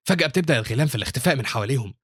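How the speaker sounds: usual speaking voice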